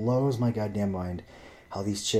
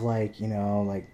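normal talking voice

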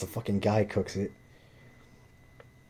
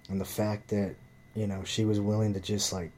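normal talking voice

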